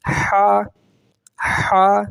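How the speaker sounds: "ہا" as a thin Arabic h from the throat; light pharyngeal "ہا". Shown as a demonstration. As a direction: breathe out "ہا" thinly on a level tone. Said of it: "باریک ہا ہے".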